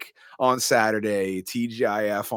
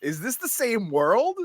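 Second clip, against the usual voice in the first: amused disbelief; 14 semitones higher, 5 dB louder than usual. Real, not acted. Joking to friends about the cop, high amused disbelief. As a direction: marvel at it up high. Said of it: "amused high incredulous".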